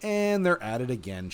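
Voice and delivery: ordinary talk, nothing marked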